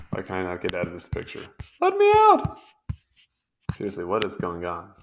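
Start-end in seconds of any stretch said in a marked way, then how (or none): 1.8-2.6 high, loud yelp to be let out; shrill-pitched shout of frustration; frustrated yell. Real, not acted. Stuck in picture, calling out.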